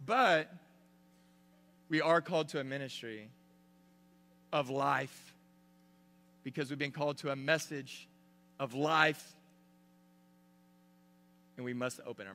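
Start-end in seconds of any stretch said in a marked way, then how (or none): none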